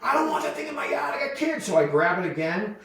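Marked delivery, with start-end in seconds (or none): 0.0-1.7 high, mocking voice of the neighbor woman; mock angry neighbor. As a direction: play the fussy neighbor lady, pitched way up. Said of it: "and she's like".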